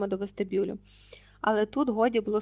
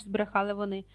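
plain reading voice

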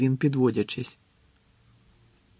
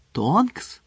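"Тонкс" raised, with a rising questioning tune; surprised question; 6 semitones higher than usual. A narrator's reading. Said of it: "одночасно здивувалися Рон і Герміона". («Тонкс» здивовано, з висхідним тоном)

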